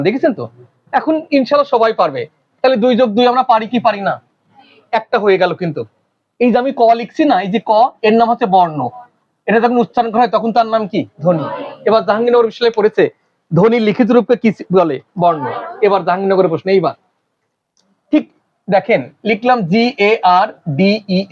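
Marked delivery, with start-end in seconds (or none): none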